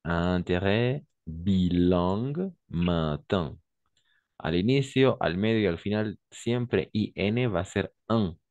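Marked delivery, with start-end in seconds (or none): 0.0-3.6 "Intérêt, bilingue, matin" slowly, nasal un; slow nasal demo. Shown as a demonstration. Teacher drilling nasal words slowly for the student. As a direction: draw out each word with a nasal un. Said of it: "Un peu nasal".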